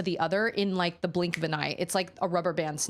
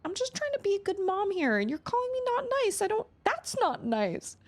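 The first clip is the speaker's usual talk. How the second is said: put-on much higher, childish whining mom; mock childish whine. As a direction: whine back like a hurt kid. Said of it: "And I was like".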